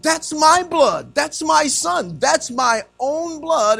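high, loud, slow preacher's proclamation; God's emphatic declaration. Acted. Preacher speaking as God about his Son, emphatic. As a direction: proclaim each phrase slowly, pitched high.

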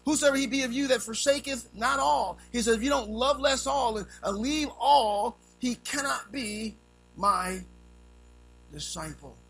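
loud, high preaching; preaching at full force. Acted. Preacher voices Jesus to the crowd, loud and high. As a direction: drive the words home to the congregation.

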